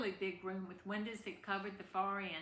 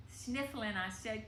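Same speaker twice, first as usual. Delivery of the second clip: ordinary delivery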